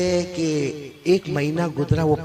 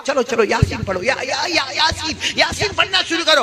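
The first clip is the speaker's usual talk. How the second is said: urgent teacher; 7 semitones higher than usual. Retold or imitated. Teacher urges students to recite.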